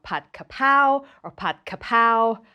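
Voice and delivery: "Pat Kapow" with no R. Shown as a demonstration. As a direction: say "Kapow" for Kaprao, dropping the R. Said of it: "to omit the R altogether".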